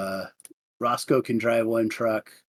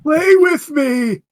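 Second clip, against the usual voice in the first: high, loud, slow playful whine; hammed-up plea to the players; mock plea; 12 semitones higher, 8 dB louder, much slower than usual. Real, not acted. GM pleads laughing with players.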